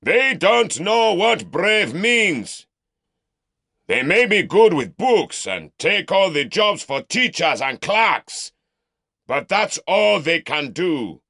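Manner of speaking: mock the Southerner for the mob; scornful shout. Uncle mocking nephew before crowd.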